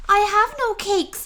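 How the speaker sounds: small and nervous, pleading; timid rabbit. Acted. Rabbit answers the rat.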